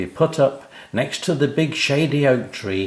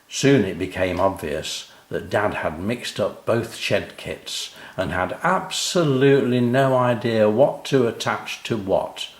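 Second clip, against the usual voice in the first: plain reading voice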